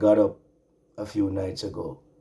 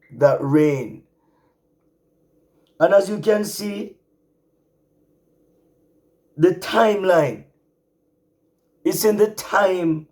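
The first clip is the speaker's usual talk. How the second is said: higher, louder preaching, drawing out key words; full-force preaching; 7 semitones higher, 10 dB louder than usual. Acted. Preacher exhorting his remnant brothers and sisters, fiery and high. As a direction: preach it, lift the congregation.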